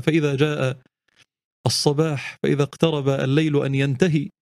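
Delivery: ordinary talk, nothing marked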